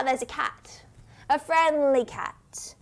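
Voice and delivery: normal talking voice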